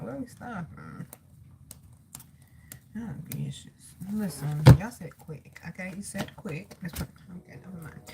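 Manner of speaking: soft, low-volume talk to oneself; quiet aside. Real, not acted. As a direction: murmur privately, as if checking with God. Talking quietly to God mid-reading.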